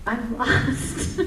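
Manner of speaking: embarrassed laugh through the words; laughing, lost. Real, not acted. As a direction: laugh it off, confused.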